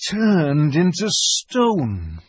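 hushed-story horror, drawn out; shuddering dread. A narrator's reading. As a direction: draw each word out with a shudder. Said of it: "he paused and shuddered".

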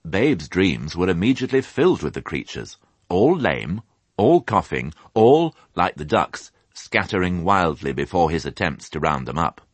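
plain reading voice

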